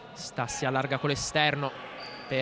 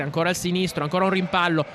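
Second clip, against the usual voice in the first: ordinary delivery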